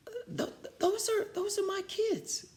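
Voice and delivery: very high, stammering scratchy voice; scratchy stammering old lady; scratchy shocked voice. Acted. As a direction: sputter it out in disbelief. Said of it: "she said in a scratchy voice".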